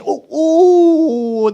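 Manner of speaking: high, long-drawn "ooh" gliding down; mock entranced oohs. Mocking young people seeing animation, high entranced oohs. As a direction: mimic a brain lighting up at animation. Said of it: "then their mind turns on".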